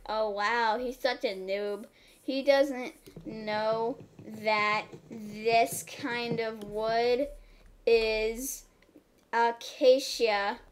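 slow, stretched playful tone; slow mocking drawl. Imitating a viewer calling her a noob, slow mocking. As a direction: drag it out slowly like a know-it-all.

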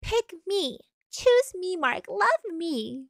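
high whiny begging voice; high pleading girly voice; pick-me girl. Retold or imitated. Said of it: "This girl sounds like a pick me girl".

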